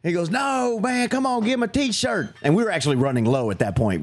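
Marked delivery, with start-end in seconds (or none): 0.3-2.3 high-pitched imitation of a pushy fan; pleading dad. Father begging for his boy's t-shirt, high pleading. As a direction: play a wheedling guy, pitched way up.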